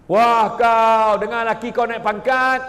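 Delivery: loud, slow, high mocking gasp; envious gossip. Jealous woman mocks a husband's promotion loudly. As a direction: drag it out with jealous surprise.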